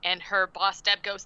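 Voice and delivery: usual speaking voice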